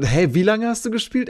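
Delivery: shocked he's already so far ahead; alarmed question. Retold or imitated. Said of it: "So, wa".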